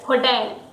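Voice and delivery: "hotel" as ho-TEL; stress on TEL. Shown as a demonstration. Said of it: "the focus is on tail".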